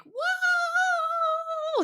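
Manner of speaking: very high whooping mock cheer; mock hype whoop. Mocking hyped-up business coaches with a very high whoop. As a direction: parody an over-the-top hype coach. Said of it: "the one like".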